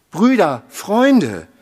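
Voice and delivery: loud, high call for help. A narrator's reading. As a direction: call the friends loudly. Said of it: "rief er". (lauter Hilferuf)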